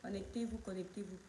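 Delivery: hushed, lilting call to her viewers to join; soft sing-song call. Real, not acted. Streamer softly calling her followers to connect, quiet sing-song. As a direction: coax the audience in with a soft chant.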